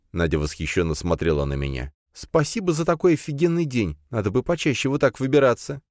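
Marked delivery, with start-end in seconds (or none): 2.1-5.8 put-on young woman voice. A narrator's reading. Princess thanks him.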